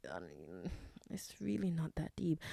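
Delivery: ordinary delivery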